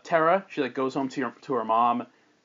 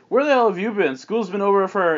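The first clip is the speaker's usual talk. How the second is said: raised, demanding mom voice; scolding mother; 5 semitones higher, 4 dB louder than usual. Acted. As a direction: demand an explanation like an angry mother. Mom scolds late daughter.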